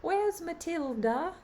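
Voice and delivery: put-on high, little girl's voice, far above her own; a curious small child asking around; little British girl. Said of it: "She was this little British girl".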